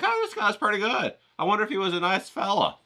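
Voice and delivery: lightly voiced admiring stranger, pitched high; imagined museum visitor. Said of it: "you'd be like".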